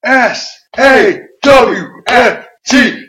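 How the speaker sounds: very slow, loud, high spelling out each letter; drawn-out spelling. Retold or imitated. Cass spelling out the insult to the Dudleys, slowly. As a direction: stretch each letter out like a chant. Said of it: "spell it out".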